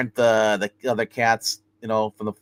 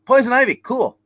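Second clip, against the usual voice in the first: excited; 11 semitones higher, 6 dB louder than usual. Real, not acted. Excited about the comic cover.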